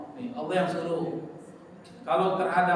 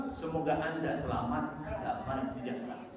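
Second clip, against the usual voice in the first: slow, softer and softer wish of peace; gentle blessing; 3 semitones lower, 7 dB quieter than usual. Shown as a demonstration. Preacher softly voicing a greeting of peace to listeners, tender. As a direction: ease into a tender blessing, fading away. Preacher explains meaning of salam.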